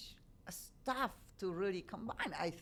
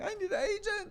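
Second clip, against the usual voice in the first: high, loud, mock-desperate cry; squeaky hammed-up panic; desperate plea; 6 dB louder, faster than usual. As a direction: play a frantic actor begging for an agent. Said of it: "everybody's running".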